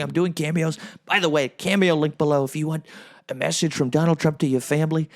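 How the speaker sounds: ordinary delivery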